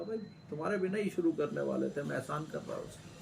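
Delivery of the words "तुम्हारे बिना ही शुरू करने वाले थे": normal talking voice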